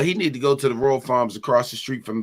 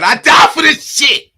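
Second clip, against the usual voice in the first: hyped shout; 12 semitones higher, 10 dB louder than usual. Retold or imitated. Hyped quote of a teammate.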